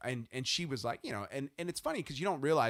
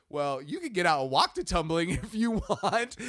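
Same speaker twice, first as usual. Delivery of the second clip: teasing dad, laughing; 5 semitones higher, 6 dB louder than usual. Retold or imitated. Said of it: "I was like".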